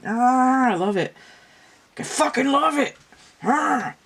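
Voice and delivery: high, loud squeals of love for the song; ecstatic. Acted. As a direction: gush like an overjoyed kid. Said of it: "This is like".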